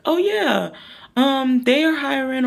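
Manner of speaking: high, airy fake-casual dodge; mock breezy brush-off. Brushing off the friend asking about jobs, high and airy. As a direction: toss it off like it's nothing.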